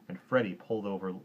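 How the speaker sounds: plain reading voice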